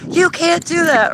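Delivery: high pitched scolding yell; retold yelling. Woman yelling up at the UFO, high scolding shout. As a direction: yell it up at the sky. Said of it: "looking up at this thing yelling".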